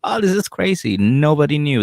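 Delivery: high, hammed-up fake astonishment; mock surprise. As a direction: ham up the innocent act. Mocking faked surprise.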